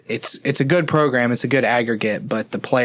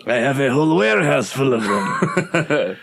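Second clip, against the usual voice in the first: light Eastern European voice; a hint of an Eastern European accent; 3 semitones higher than usual. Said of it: "all my accents go to the Eastern European voice".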